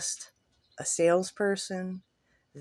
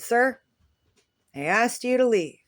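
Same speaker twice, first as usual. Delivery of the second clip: firm order; 5 semitones higher, 5 dB louder than usual. Retold or imitated. Ordering the man out.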